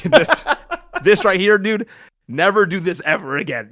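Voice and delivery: high, loud, laughing through the words. Real, not acted. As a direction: crack up while warning him off it. Laughing while retelling coaching.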